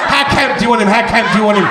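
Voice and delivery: loud comic protest; mock exasperation. Comic protesting his character's camp makeover, loud mock exasperation. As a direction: throw it out loudly, as if fed up.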